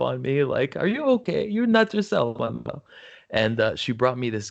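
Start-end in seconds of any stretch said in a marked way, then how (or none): 0.7-2.8 imitating a Norwegian accent, much higher pitched; caring high Norwegian accent impression; high sing-song Norwegian accent, fading at the end. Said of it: "she's got this Norwegian accent".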